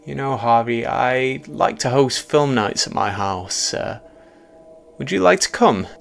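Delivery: eager, slightly hesitant American invitation; jovial American Mark. A narrator's reading. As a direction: invite as a friendly American host.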